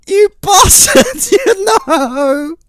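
high, loud, drawn-out wail; real high-pitched outrage; anguished cursing. Real, not acted. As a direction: curse the game in a slow wailing yell. Cursing the game after failing.

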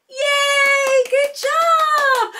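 high, cheering praise; big high-pitched hooray; excited cheer. Real, not acted. Cheers the child on.

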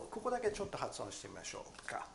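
usual speaking voice

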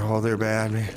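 ordinary delivery

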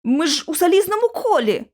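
high-pitched, disbelieving cry; alarmed protest. A narrator's reading. Narrator threatened inside the iron circle, alarmed. Frightened protest under attack.

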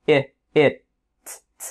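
"It" broken up, the "t" released alone without voice; sounds split apart. Shown as a demonstration. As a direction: separate the "t" as a bare puff. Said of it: "phát tất cả mọi âm đơn lẻ rời rạc".

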